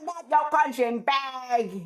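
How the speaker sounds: high, slow put-on old lady voice; grandma singing along to a record she loves; imitating grandma. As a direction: drawl it out like a feisty grandma. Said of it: "she goes".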